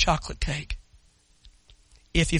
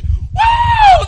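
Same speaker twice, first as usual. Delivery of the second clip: high whooping cheer; 10 dB louder than usual. Real, not acted. Celebrating people getting saved.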